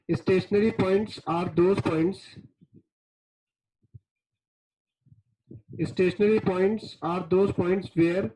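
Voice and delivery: very slow, deliberate dictation pace. Acted. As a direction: spell it out slowly for notes. Teacher dictates definitions slowly.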